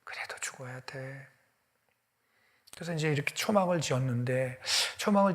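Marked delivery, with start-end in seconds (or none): 0.0-1.3 unvoiced, stubborn whisper; whispered grudge. Jonah sulking outside the city, whispering. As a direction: hiss the grudge under your breath. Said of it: "시위를 하고 있습니다".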